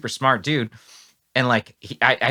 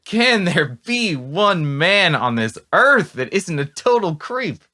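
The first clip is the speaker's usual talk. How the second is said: higher, louder, slow exasperated plea; exasperated outcry; 8 semitones higher, 4 dB louder, slower than usual. Real, not acted. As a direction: throw up your hands at humanity. Outraged at disappointing idol.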